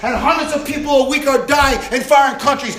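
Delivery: loud, driving preacher's cadence; preaching at full force. Acted. Pastor stirring the congregation about faith, full-force preaching. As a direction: preach it and build to the crowd.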